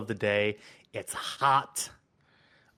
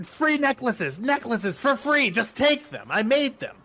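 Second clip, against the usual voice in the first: high, loud pleading hawker's cry; frantic high-pitched street seller; desperate vendor shouting; 13 semitones higher, 6 dB louder than usual. Acted. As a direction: shout your wares at passersby, pitched way up and begging. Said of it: "shouting at you".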